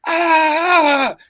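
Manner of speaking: high loud startled yelp; retold scream. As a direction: shriek like you just saw a snake. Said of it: "I screamed like a little bitch".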